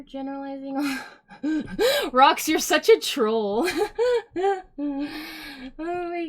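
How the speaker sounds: laughing through the words, high and loud. Real, not acted. Streamer laughing at a chatter, calling him a troll. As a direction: crack up while teasing.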